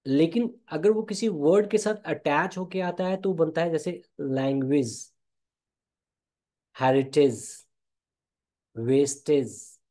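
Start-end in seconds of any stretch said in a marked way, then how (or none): 4.2-9.8 "लैंग्वेज, हेरिटेज, वेस्टेज" ending in a short IZ; attached -age pronunciation. Shown as a demonstration. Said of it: "वो इज बोलता है". ("लैंग्वेज, हेरिटेज, वेस्टेज" के अंत में छोटा इज़)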